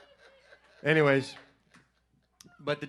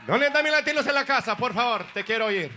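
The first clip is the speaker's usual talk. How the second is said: higher, louder, fast crowd shout; hype call-out; 6 semitones higher, 5 dB louder, faster than usual. Shown as a demonstration. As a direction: pump up the crowd like an MC.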